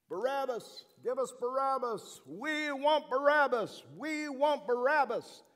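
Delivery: high, loud chanting mob demand; crowd yelling. Retold or imitated. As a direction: roar it like a crowd wanting Barabbas. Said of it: "that crowd started yelling at me".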